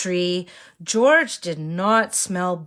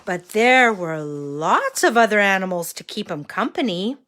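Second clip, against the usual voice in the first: put-on reading to a toddler; 3 semitones higher than usual. A narrator's reading. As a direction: read it like to a small child. Reads story to children.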